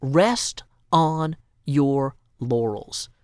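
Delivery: very slow, grand pronouncement; solemn proclamation. Shown as a demonstration. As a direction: read the prompt like a decree. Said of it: "This poetry prompt is an imperative".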